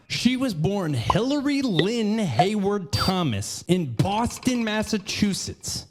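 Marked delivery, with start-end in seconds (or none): none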